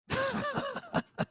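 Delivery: soft, high wheezy laugh; helpless quiet giggling. Real, not acted. Cracks up blaming buddy Sonny.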